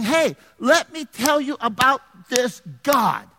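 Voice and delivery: high, loud pulpit proclamation, key words drawn out; impassioned preaching. Acted. Pastor telling the congregation they bear good news, impassioned preaching. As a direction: preach it out with rising force.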